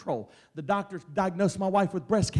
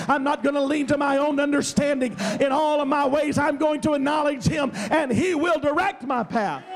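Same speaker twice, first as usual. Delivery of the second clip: loud, high, fervent pulpit preaching; full-force preaching; 6 semitones higher, 7 dB louder than usual. Acted. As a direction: build it like a revival sermon, voice ringing out. Preaching climax to congregation.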